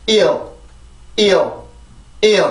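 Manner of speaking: 일 said as a gliding i-eo-l. Shown as a demonstration. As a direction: say 일 with the tongue rising into a dark L. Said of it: "여기부터 발음을 해요". (일을 '이어'처럼 미끄러지듯 발음)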